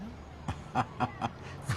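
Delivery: soft voiceless chuckle; laugh. Acted. Buyer runs into an acquaintance at the dealer, chuckling.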